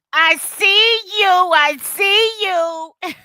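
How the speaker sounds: slow, high teasing I see you; drawn-out sing-song shout-out; playful "I see you". Pretend. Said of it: "Let me stop with the "I see you".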